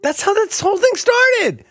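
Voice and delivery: very high-pitched rural impression; Slim Pickens. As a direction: pitch it way up like an excitable cowpoke. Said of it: "in my impersonation of him".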